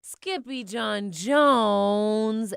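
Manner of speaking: loud, drawn-out group cry. Acted. Everyone seeing the kitty boy burst out, loud drawn-out cry. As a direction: stretch the name out loud in surprise. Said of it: "everyone cried".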